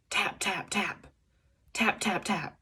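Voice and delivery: quiet tapping. A narrator's reading. Tapping sounds in the egg.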